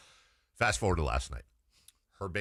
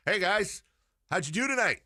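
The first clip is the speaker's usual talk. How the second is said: higher, louder cheery dad hello; friendly greeting; 7 semitones higher, 3 dB louder than usual. Retold or imitated. Dad loudly greeting his daughter's bandmates, cheery. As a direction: greet the boys brightly to embarrass her.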